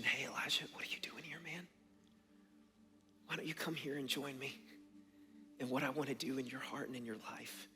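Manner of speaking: gentle invitation. Acted. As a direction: invite softly, like a dad to a scared kid. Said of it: "as an invitation".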